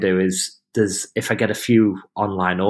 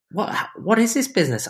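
amazed customer; 10 semitones higher than usual. Retold or imitated.

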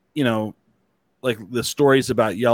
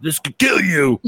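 hammed-up menacing yell, higher pitched; mock threat; 4 semitones higher than usual. Joking parent scaring a kid into masking, mock menacing yell. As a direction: yell a pretend warning in a put-on voice. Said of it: "you'll be like".

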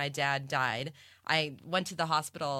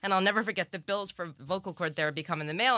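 ordinary talk, nothing marked

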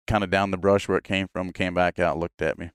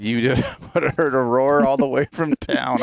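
laughing retold quote; 6 semitones higher, 4 dB louder than usual. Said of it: "He said".

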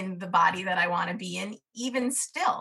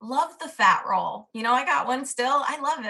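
ordinary delivery

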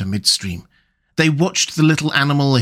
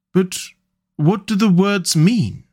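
wondering; 6 semitones higher than usual. Acted. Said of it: "asked the wondering mole".

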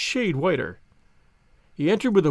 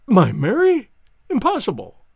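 loud, higher cry; shocked disbelief; 5 semitones higher, 5 dB louder than usual. Acted. Banker hears of niece's flight, shocked. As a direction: refuse to believe.

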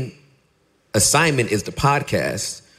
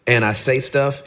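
normal talking voice

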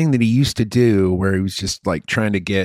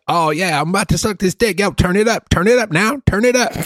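high, excited radio-guy voice climbing higher; hammed-up DJ impression; 11 semitones higher than usual. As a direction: parody an overeager rock jock. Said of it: "doing like a California guy".

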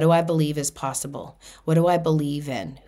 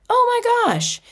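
much higher, louder oh my gosh; delighted; 4 dB louder than usual. Real, not acted. Telling listeners about her great day, delighted and high. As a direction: gush with delight. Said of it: "delighted exclamation".

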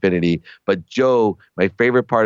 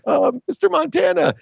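high, starstruck squeak; a tongue-tied fan meeting his idol, much higher; nervous fanboy. Retold or imitated. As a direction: awestruck kid voice, much higher. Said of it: "I was so nervous".

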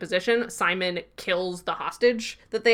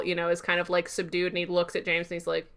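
ordinary talk

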